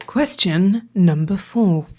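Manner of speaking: slow deep announcer voice; deep announcer echo. Acted. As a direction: echo it low and slow like a trailer. S1 echoes in lower voice.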